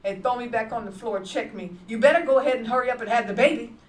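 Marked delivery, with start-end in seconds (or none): 1.9-3.8 loud, fast, harsh command; a retold abuser yelling; husband's angry shout. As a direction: bark it at her like a threat. Abusive husband voiced in story.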